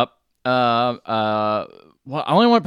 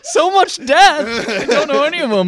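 laughing mock grief; 13 semitones higher, 4 dB louder than usual. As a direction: wail it out while laughing. Mock grieving over celebrity deaths.

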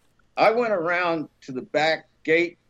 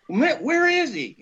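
eager questioning; 13 semitones higher than usual. Retold or imitated. Boss caught up in his monologue, high and insistent. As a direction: imitate a listener hooked and demanding to know.

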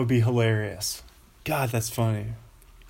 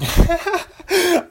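high giggle; 8 dB louder than usual. Real, not acted. Laughs mid-sentence.

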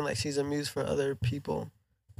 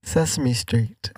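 slow savoring; 4 dB louder, much slower than usual. Pretend. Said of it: "That was beautiful".

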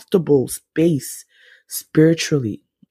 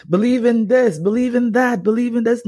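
much higher mock preacher chant; mocking preachy voice; 9 semitones higher than usual. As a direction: parody the preachers, pitched high. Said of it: "we've been taught that for so long".

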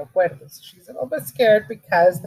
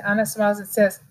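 usual speaking voice

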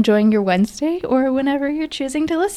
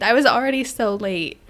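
normal talking voice

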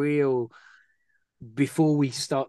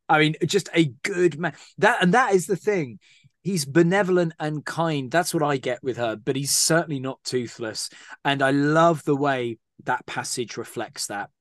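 normal talking voice

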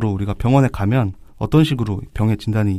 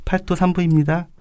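higher-voiced brisk nurse report; 7 semitones higher than usual. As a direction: answer like a quick nurse. Nurse role in skit. (간호사 역할 목소리)

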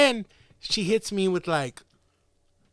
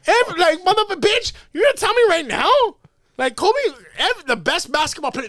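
heated rant; 9 semitones higher, 5 dB louder than usual. Retold or imitated. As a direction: build it up louder and higher, worked up. Ranting at girl in retold story.

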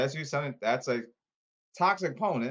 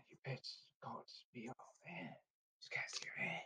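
whispered trash talk; whispering; 24 dB quieter than usual. Acted. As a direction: hiss it under your breath. Muttered trash talk aside.

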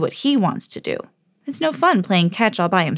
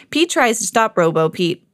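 ordinary delivery

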